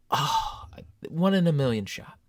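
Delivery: ordinary delivery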